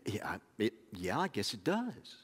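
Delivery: sheepish admission. Retold or imitated. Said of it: "And he said".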